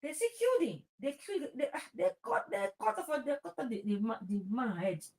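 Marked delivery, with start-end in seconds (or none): none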